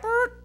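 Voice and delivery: high trilled "brr"; short rising lip-buzz of gibberish; imitated unintelligible noise. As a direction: mimic meaningless chirpy mumble. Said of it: "it sounds like".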